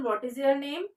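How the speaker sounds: ordinary talk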